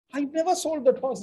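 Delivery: never pitched high and stressed; indignant stress on never. Shown as a demonstration. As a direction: protest with weight on never. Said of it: "depending on where the emphasis is".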